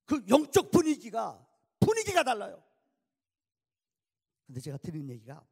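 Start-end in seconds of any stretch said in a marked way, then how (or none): 0.1-2.6 preaching at full force. Acted. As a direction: stretch out the spiritual atmosphere. Preaching climax.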